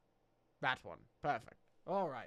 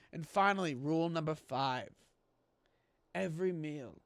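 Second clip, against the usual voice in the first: ordinary talk, nothing marked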